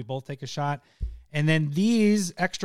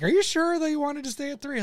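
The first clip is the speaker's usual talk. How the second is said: higher teasing voice; 11 semitones higher than usual. Retold or imitated. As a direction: ask it high and teasing. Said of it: "I was like".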